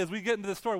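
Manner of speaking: usual speaking voice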